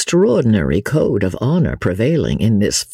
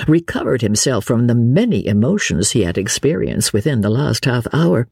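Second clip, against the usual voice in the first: ordinary delivery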